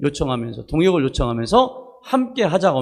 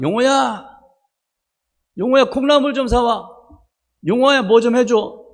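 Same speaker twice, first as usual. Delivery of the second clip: higher, louder mother calling her eldest son; 6 semitones higher, 4 dB louder than usual. Retold or imitated. Mother calling her son Yongho on errands, higher and louder. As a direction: call out to the boy like his mom. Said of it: "엄마가 뭔 심부름 시키면요".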